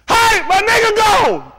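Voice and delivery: high, loud, slow call; shouting slave owner. Acted. Playing an owner calling neighbours to chase a runaway, shouting. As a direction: raise the alarm across the field.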